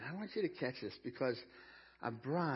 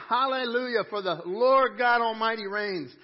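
booming hallelujah, pitched high; thunderous voice; 7 semitones higher, 13 dB louder than usual. Acted. Preacher voices the angels for the congregation, thunderous. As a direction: proclaim it very loud, like thunder from heaven. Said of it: "It's this thunderous voice".

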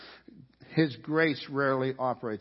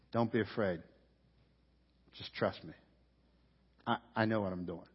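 much softer, lower, tender; gentle reassurance; 5 semitones lower, 5 dB quieter than usual. Retold or imitated. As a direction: hush him with calm trust. Jesus voiced reassuring Jairus.

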